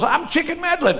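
high, excited Southern twang; South Carolina accent. Retold or imitated. Shipmate introducing himself in boot camp days, South Carolina accent. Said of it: "He's from South Carolina".

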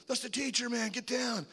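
high-pitched mock-panic warning; retold urgent warning. Teen warning buddy about the teacher, urgent. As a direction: urgently get your buddy to duck.

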